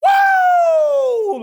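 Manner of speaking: high, loud whoop; celebratory woo. Retold or imitated. Speaker reenacting celebrating a friend's contract, loud whoop. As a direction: whoop like you just heard great news. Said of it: "what did I do?".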